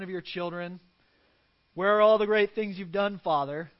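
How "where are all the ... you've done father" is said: put-on loud, cheeky child's challenge; a bratty kid taunting his father; mock whiny child. Child mocking father, imagined.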